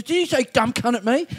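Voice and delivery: high, affronted mock accusation; imitated angry demand. Junkie thinking he was insulted, mock angry accusation. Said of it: "he gets really angry".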